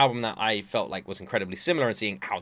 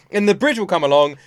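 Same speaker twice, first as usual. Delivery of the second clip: ordinary delivery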